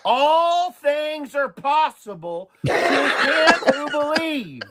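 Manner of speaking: high, slow, laughing; imitating the daughter's Bible verse while laughing; quoting daughter through laughter. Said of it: "She said".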